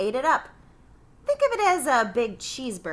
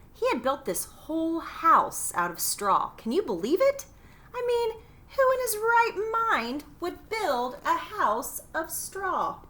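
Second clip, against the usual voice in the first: incredulous mockery; 9 semitones higher than usual. A narrator's reading. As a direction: scoff at the straw house like it's absurd. Mocking the pig's foolish straw house.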